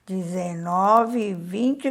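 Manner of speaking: counting slowly. Shown as a demonstration. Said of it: "ó".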